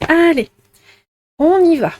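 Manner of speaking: rally the viewers to begin; eager start. Real, not acted. Tarot reader kicks off the reading for viewers, eager.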